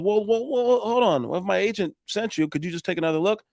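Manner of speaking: high, hurried "well, well, well" begging; pleading homeowner. Retold or imitated. Homeowner begs him to stay.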